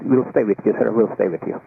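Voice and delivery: usual speaking voice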